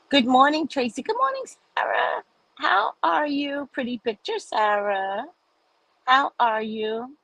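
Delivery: singing out good morning to viewers; sing-song greeting. Real, not acted. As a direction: croon the hello to a friend.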